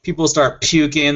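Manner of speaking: ordinary delivery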